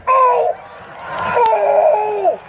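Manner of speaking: high shouted oh, oh, oh; fanboy realizing who he met; starstruck gasps. Retold or imitated. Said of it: "I went".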